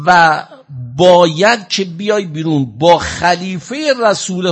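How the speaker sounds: loud, high, drawn-out declaimed threat; retold shouted ultimatum. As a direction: call it out slowly, hammering each demand as the storyteller. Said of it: "با صدای بلند فریاد زد که".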